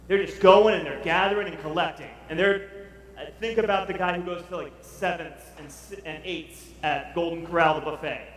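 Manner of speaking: ordinary delivery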